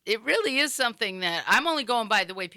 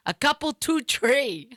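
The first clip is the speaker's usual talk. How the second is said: slow, higher Rhode Island TWO TREE; Woonsocket accent; 7 semitones higher, slower than usual. Retold or imitated. John repeating his order to the bartender, slow Woonsocket accent. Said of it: "a whole different way they pronounce stuff".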